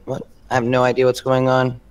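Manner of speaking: normal talking voice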